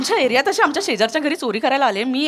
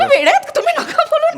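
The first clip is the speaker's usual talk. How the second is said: high mock scolding; 11 semitones higher, faster than usual.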